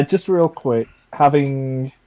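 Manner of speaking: normal talking voice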